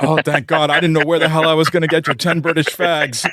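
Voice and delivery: high, gushing American relief; relieved handler. Retold or imitated. A&R guy relieved it's only cigarettes, high and gushing. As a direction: let the relief pour out.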